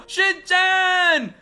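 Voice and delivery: slow, loud drawn-out yell; desperate shout. Acted. Brother fearing the ghost took the kids, desperate shout. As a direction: shout their names in despair.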